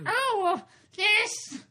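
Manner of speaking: high, loud shrieky Python housewife; Terry Jones old woman. Pretend. Mocking the shop girl as a Python woman, high shriek. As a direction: screech it like a Python lady.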